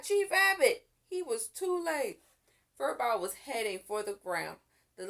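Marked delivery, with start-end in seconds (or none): 0.0-0.9 higher, louder alarmed cry; cried out. A narrator's reading. Crying out as the cat jumps away, alarmed. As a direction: shout a warning.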